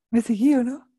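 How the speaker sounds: ordinary talk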